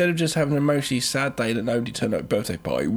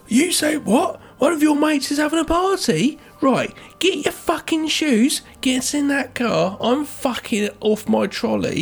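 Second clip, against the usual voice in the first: put-on drunk mum; 11 semitones higher than usual. Mum ordering her daughter into the car, drunk and shrill. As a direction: play the sozzled mum barking orders.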